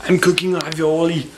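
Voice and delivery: lightly put-on Austrian action-hero accent; chunky Schwarzenegger-style vowels, half joking; Arnold accent. Cooking dinner, jokingly slipping into Arnold's accent. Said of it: "I'm talking like Arnold".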